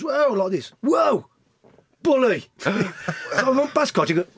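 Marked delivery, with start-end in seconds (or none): 0.0-0.3 high, sudden loud call; mock gaffer's shout. Gaffer stopping his sub on the touchline, shouted. 0.8-2.5 high, loud summons; mock gaffer's shout. Gaffer calling Bully over from the warm-up, shouted.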